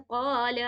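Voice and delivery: قال with its first vowel drawn out. Shown as a demonstration. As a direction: hold the long "aa" in قال. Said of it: "ایک الف کے برابر اسے کھینچ کے".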